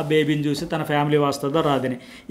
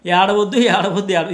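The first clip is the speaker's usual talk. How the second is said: mock urging; 3 semitones higher, 4 dB louder than usual. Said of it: "ఇదే చెప్పారు".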